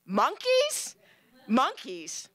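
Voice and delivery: a high startled cry, then flat; alarmed. Retold or imitated. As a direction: recoil at the monkeys. Guest alarmed at monkeys.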